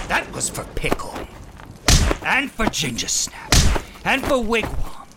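vengeful outburst. Acted. Villain strikes, avenging friends.

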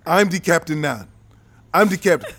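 put-on African accent, firm claim; African-accented captain bit. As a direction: take command in a mock African accent. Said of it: "That's my best African accent".

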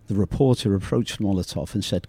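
ordinary delivery

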